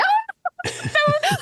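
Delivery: high squeaky gasp of fright; frightened yelp. Retold or imitated. Runner retelling a yapping dog charging her, high frightened yelp. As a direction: yelp as the little dog lunges.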